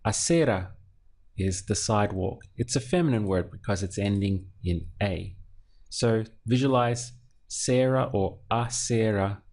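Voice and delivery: ordinary talk, nothing marked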